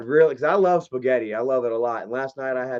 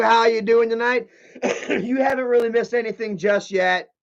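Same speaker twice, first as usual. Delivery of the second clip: normal talking voice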